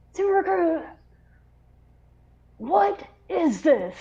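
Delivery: high, mock-stern officer voice. Officer confronting recruit over her signature, mock-stern. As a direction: play the officer demanding an answer. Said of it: "he goes".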